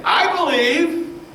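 high, loud cry of I believe; shouted belief. Retold or imitated. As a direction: call it out, slow and high. Preacher acts out his conversion.

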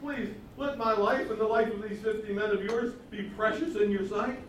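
high, begging plea; pleading captain. Retold or imitated. Third captain begging Elijah to spare him, high and pleading. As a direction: beg for your life on your knees. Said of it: "he pleaded with him".

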